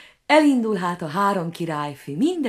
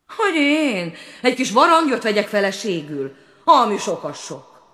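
scoffing prince; 4 semitones higher, 4 dB louder than usual. A narrator's reading.

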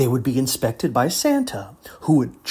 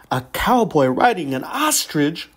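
plain reading voice

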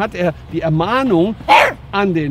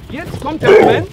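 higher and louder, mounting tension; building up; 10 semitones higher, 7 dB louder than usual. Shown as a demonstration. Trainer announces the moment to step in, high and loud. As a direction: announce the big moment loudly. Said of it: "jetzt kommt mein Moment".

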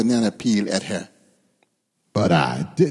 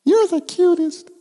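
sweet-talking bird. Acted. Lovebirds trade compliments.

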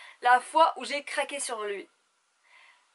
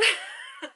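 high squealing laugh. Real, not acted. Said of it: "ça m'énerve".

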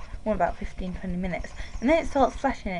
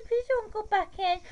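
higher whiny plea at normal volume; high upset pleading; 11 semitones higher than usual. Real, not acted. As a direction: beg it in a high, fretful voice. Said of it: "Why am I talking like that?".

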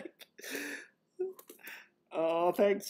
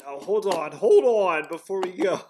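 normal talking voice

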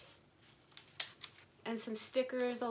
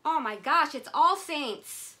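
loud, slow, higher exclamation; excited exclaim; 4 semitones higher, 11 dB louder, slower than usual. Real, not acted. Exclaiming at finding an All Saints wallet. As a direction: announce it with thrilled surprise. Recognizing the brand.

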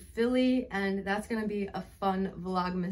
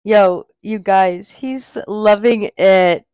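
excited call; 17 dB louder, slower than usual. Real, not acted. Excited about dog's new bed.

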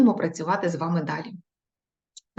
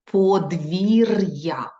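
Подвір'я drawn out slowly and louder; slow dictation; 4 dB louder, much slower than usual. Shown as a demonstration. Teacher dictating to pupils, slow and clear. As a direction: stretch Подвір'я syllable by syllable. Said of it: "Наступне".

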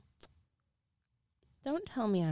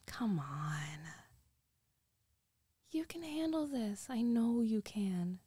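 coaxing whisper. Acted.

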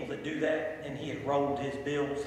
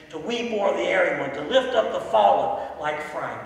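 impassioned preaching, building louder into the hymn words; rising pulpit cadence; preaching at full force; 5 dB louder than usual. Real, not acted. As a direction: drive each phrase harder toward a loud peak. Preaches, building through hymn lines.

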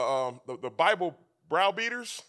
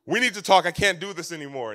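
fussing wife; 9 dB louder than usual. Retold or imitated. Wife confronting husband on the couch, loud fussing. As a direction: imitate a fed-up wife fussing. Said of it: "she's fussing about all these things".

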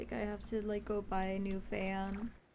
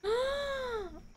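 excited gasp; 12 semitones higher than usual. Real, not acted. Sudden gasp on a new subscriber.

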